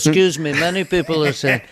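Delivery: lightly impersonated politician; a hint of a politician's repeated rumour-mongering; Donald Trump. Claiming that many people agree, light Trump impression. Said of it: "how do you define Donald Trump".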